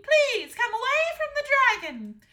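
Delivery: high, loud, rushed plea; pleading queen. A narrator's reading. Mother begging the daughter away from danger, pleading. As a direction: beg urgently in a high voice. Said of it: "pleaded the queen".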